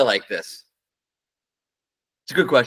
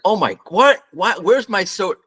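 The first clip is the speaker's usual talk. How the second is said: shocked diner; 6 semitones higher than usual. Retold or imitated. Diner whose soda was just stolen, mock surprise. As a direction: play the startled guy whose drink vanished. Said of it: "the dude there sitting there is like".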